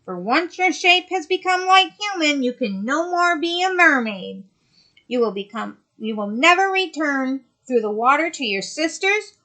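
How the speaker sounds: loom over her as the sea witch. Acted. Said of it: "said the witch".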